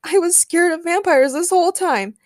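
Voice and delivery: high, loud, distressed admission; flustered confession. A narrator's reading. Peter confesses his fear to Daniel, flustered. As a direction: blurt out the embarrassing truth. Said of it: "Peter stammered".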